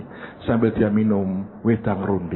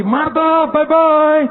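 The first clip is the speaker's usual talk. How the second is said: much higher, louder shouted farewell; calling out; 7 dB louder, much slower than usual. Retold or imitated. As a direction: yell goodbye into the house. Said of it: "dia teriak lagi". (berteriak pamit dengan suara tinggi dan keras)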